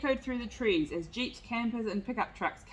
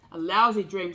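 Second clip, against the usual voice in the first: louder, a bobcat's mild snarl; 2 semitones lower, 8 dB louder than usual. A narrator's reading. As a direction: spit it out with contempt. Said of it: "snarled a bobcat".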